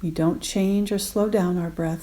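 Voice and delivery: normal talking voice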